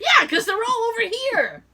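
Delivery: high, put-upon sarcastic whine; sarcastic complaint. Retold or imitated. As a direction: whine it with mock annoyance. Mock-complaining to companion.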